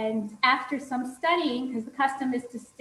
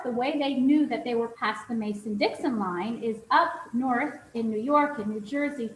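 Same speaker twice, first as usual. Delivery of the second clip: usual speaking voice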